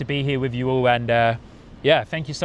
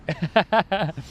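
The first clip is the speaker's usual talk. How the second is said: short laugh; 5 semitones higher than usual. Real, not acted. Laughing at friends' teasing.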